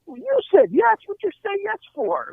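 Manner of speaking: high-pitched baffled complaint; mock exasperation; retold incredulous protest. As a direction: protest in comic disbelief, pitched well up. Replaying argument with his wife.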